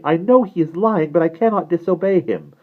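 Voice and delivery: put-on high, plaintive girl's voice; high, anguished confession; soft cry. A narrator's reading. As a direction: plead helplessly in a high pitch. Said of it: "cried Ariel softly".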